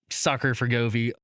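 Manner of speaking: ordinary delivery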